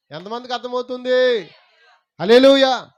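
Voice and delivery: loud, high-pitched call to the congregation; rousing call. Real, not acted. Calls congregation to respond. (గొంతెత్తి బిగ్గరగా పిలుస్తూ)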